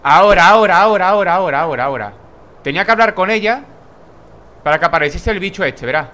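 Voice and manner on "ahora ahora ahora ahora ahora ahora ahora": loud, urgent chanting of ahora; egging himself on, fast and loud; hyped gamer yelling at the screen; excited urging. Real, not acted. Urging on during boss fight.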